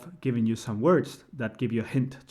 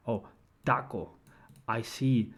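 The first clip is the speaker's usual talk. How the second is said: ordinary talk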